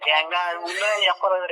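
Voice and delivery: ordinary delivery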